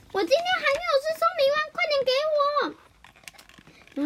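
put-on very high kid voice, rushing then slowing on the demand; child nagging for the pill; 急切的小孩. 绿豆冰讨药丸. (很高的童声，先快后拖长地讨要)

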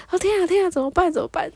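high-pitched mock panic; retold flustered worry; nervous. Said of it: "然后就很紧张啊".

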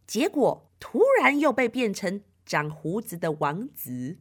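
usual speaking voice

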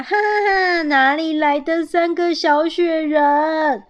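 high, loud acted ha-ha-ha, then drawn-out teasing; laughing. A narrator's reading. Squirrel dad running up to three little snowmen, laughing. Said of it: "爸爸笑着跑过来".

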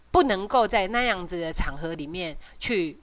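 normal talking voice

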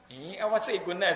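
normal talking voice

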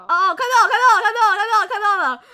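loud, high, rapid repeated finding it; excited loud found-it chant. Real, not acted. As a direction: blurt it out thrilled at finding it. Found the chat record.

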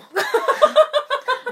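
high loud laugh. Real, not acted. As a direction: burst out laughing. Laughs at own card.

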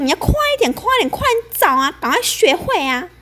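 high, fast mock nagging; nagging senior. Senior pressures newbie to find records, mock nagging. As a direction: rush her with a shrill hurry-up. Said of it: "一直逼我".

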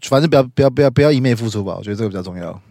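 ordinary talk, nothing marked

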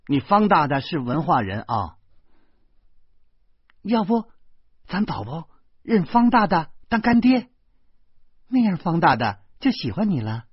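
high, loud, lively woman's voice; coaxing mother. A narrator's reading. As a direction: talk a little child into it brightly. Lina coaxes child in story.

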